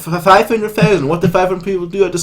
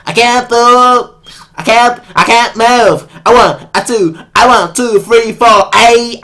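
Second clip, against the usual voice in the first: loud, higher hammed-up rock king rant; mock rock star, louder and higher; 8 semitones higher, 4 dB louder than usual. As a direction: strut like a rock god on stage.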